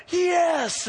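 high, loud shouted "Yes"; eager crowd yelling yes. Retold or imitated. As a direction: belt the answer back like a crowd. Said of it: "And it's like".

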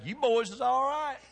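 Southern Kentucky voice, hammed up a little; his own Kentucky talk. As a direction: say it like a plain Kentucky boy, loud and proud. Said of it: "Guess what I say?".

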